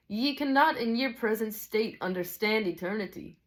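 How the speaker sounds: storybook Scotsman, lilting and measured; Scottish teacher. Acted. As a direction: teach solemn mysteries in a Scottish character voice.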